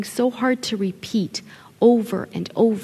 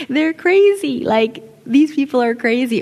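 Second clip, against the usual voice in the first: high, loud retold bewilderment; mock disbelief; 6 semitones higher, 6 dB louder than usual. Husband on his new Italian in-laws, mock disbelief. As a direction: exclaim it as the baffled outsider.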